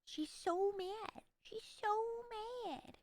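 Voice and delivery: slow, high cooing; pet baby talk. Pretend. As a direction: coo teasingly over a cross little pet. Coos about the angry pet.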